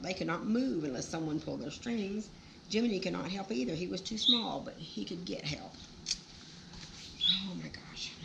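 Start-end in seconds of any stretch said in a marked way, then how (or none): none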